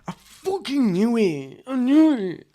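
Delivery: loud, high exasperated cry; frustrated outburst. Real, not acted. Finding his camera dead mid-podcast, a loud, high frustrated outburst. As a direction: let the annoyance burst out.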